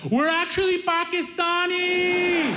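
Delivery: very high, drawn-out holler; called-out correction. Retold or imitated. Cousin calling out the car window, high-pitched holler. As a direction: sing out the correction in a long high cry. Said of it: "Hamza rolls down his window and he says".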